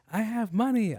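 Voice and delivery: niece pleading. Retold or imitated. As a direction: pipe up hopefully like a kid. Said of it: "she was like".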